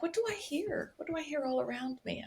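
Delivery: softly asking oneself, voice lifted; hushed wondering. Pretend. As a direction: pretend to listen out, quiet and curious. Acting out listening inwardly.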